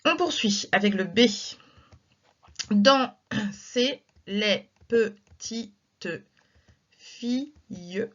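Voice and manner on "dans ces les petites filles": very slow, syllables pulled apart; slow scansion. Shown as a demonstration. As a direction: beat out the verse slowly. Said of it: "Un, deux".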